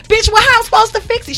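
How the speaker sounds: indignant outburst. Retold or imitated. Retold fight with apartment clerk.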